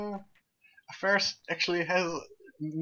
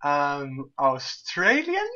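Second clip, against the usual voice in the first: clueless contestant; 6 dB louder, much slower than usual. Retold or imitated. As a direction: drag out a mock-dumb guess. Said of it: "not too very intelligent blondes".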